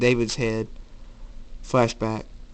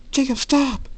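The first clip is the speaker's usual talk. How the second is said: high, raised cry to stop; panicked plea; 4 dB louder than usual. Acted. Girl begs Jacob to stop the beating, high urgent cry.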